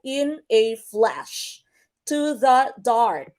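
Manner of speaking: slow "in a FLASH", "To the DARK"; measured syllables, landing on "flash" and "dark"; last-syllable stress. Shown as a demonstration. Teacher showing anapestic examples, stress on final FLASH and DARK. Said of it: "last syllable would be stressed".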